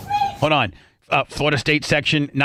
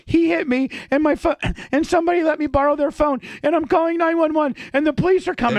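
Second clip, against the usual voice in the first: much higher and rushed, imitating her frightened call; panicked girlfriend; 12 semitones higher, faster than usual. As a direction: rattle it off high like she's scared and crying. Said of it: "She's like".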